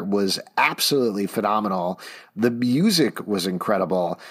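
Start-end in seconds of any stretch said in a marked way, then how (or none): none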